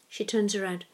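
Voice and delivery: usual speaking voice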